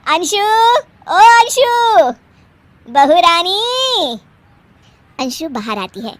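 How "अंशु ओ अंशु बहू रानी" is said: loud, high, long-drawn call. Acted. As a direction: summon someone from another room. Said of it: "अभी बुलाती हूँ". (ऊँची आवाज़ में पुकारना)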